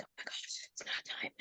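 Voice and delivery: barely audible whisper, retold; whispered dismay. Teller relives reaching the other side, hushed whisper. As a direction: whisper it as a stunned private reaction.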